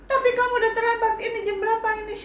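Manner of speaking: put-on mother berating her child for being late; angry mother. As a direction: fire it at the child, raging.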